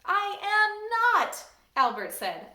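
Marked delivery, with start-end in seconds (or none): 0.0-1.4 high, loud and drawn out; slow, whiny child's denial; indignant protest. Acted. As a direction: stretch it out in protest.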